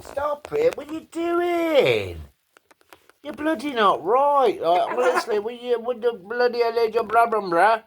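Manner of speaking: high laughing exasperated telling-off; laughing scold. Real, not acted. Owner scolds the parrot while laughing, pitch up.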